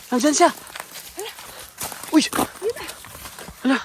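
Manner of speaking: sudden loud cry; alarmed shout. Real, not acted. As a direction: shout that it's here. Creature spotted, calling out. (malakas na sigaw sa gulat)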